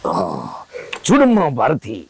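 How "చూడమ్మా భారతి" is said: low film-star dialogue delivery; Mohan Babu. Acted. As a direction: drop low and declaim like a movie hero. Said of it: "Mohan Babu sir లెక్కనే మాట్లాడుతున్నావు".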